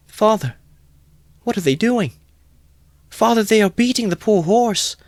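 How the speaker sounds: put-on very high, pleading child's voice; distressed child. Little boy begging his father over the horse, high pleading. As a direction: beg father in horror.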